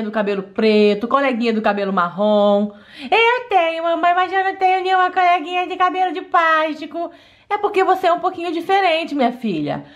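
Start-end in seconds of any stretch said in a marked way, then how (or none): 3.1-7.1 put-on higher, childlike doll girl voice; little girl voice. Daughter complaining to mom about school, higher childlike voice. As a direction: pout like a hurt little girl.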